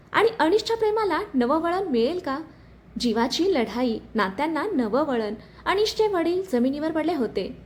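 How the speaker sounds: ordinary delivery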